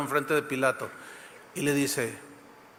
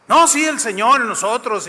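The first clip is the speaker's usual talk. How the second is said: mock piety; 5 semitones higher, 5 dB louder than usual. Mocking hypocrites' pious talk.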